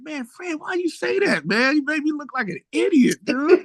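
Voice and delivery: high, whiny mock complaint; mad at Fred. Young singer scolding his accountant Fred, high whiny complaint. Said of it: "I was mad at Fred".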